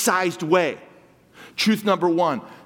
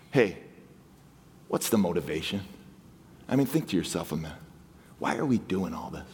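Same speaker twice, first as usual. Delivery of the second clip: softer, lower inward question; hushed reflection; 12 semitones lower, 7 dB quieter, faster than usual. Real, not acted. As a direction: drop much softer and lower, almost private. Reflective aside to congregation.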